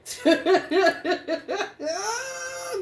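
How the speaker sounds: real laughter breaking out, high; long high laugh. Real, not acted. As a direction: laugh hard, almost crying. Laughing at divine masculine.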